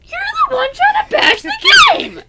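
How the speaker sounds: very high, loud accusation; outraged high-pitched protest; indignant shout. Real, not acted. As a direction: yell the accusation back. Accusing partner in heated banter.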